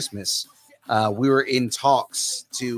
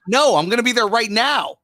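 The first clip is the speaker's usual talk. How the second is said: high, loud mock urgency; play-acted excited shout; eager outburst; 12 semitones higher, 7 dB louder than usual. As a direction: blurt it out high and loud, as if jumping at the offer. Acting out eager reply to offer.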